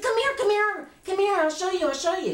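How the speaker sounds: put-on very high, urgent little-girl voice; excited child. Child urging her mother to come and look, excited. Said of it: "I was so excited".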